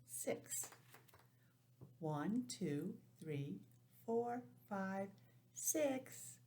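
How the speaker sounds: ordinary delivery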